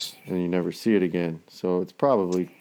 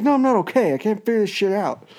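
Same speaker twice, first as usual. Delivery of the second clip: very high, loud whiny wail; mock stoned despair; 13 semitones higher, 5 dB louder than usual. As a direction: play up the helpless panic. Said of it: "I'm like".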